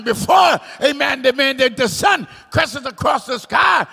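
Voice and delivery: high, loud preacher's cry; shouted, high-pitched pulpit fervour; full-force preaching. Real, not acted. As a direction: build repeated words into ringing shouts.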